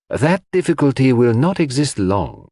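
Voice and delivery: ordinary delivery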